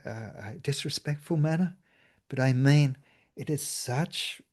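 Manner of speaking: ordinary talk, nothing marked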